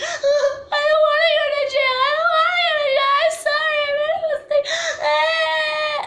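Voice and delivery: high, loud wailing plea; hammed-up crying, begging in a squeal; mock bawling. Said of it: "like, literally crying".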